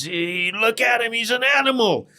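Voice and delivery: high, loud imitation of a screaming man; retold scream. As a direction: scream it out like the outraged man in the story. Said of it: "he just starts screaming".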